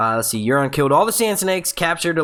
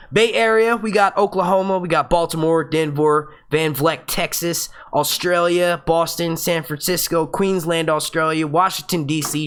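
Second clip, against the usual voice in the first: ordinary delivery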